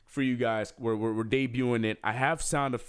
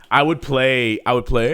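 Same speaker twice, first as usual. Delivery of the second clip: loud; 2 semitones higher, 13 dB louder than usual. Acted. Singing game show tune loudly.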